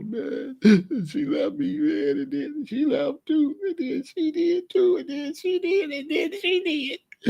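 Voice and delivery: high, wailing fake sob; mock crying. Comic imagining himself crying over five girls, high mock wail. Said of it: "I could be crying over".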